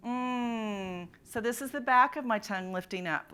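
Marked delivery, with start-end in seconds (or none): 0.1-1.1 "mm" hummed as a long NG; last nasal sound. Shown as a demonstration. Teacher demonstrating the last nasal, long NG hum. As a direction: hold "mm" from the lifted back of the tongue. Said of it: "the last sound is".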